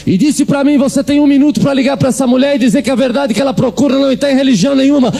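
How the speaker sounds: high, fervent preacher build; preaching at full force. Acted. Preacher tells the congregation of the phone call, preaching at full force. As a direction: drive the climax home.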